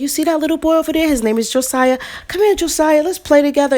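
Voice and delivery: put-on talking sweetly to a lost child; sweet coaxing voice. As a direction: coax the little boy gently. Coaxing a lost little boy.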